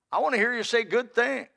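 ordinary delivery

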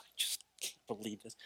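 retold hushed dismay; quiet disbelief. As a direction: shake your head and mumble it in disbelief. Man shaking head in despair.

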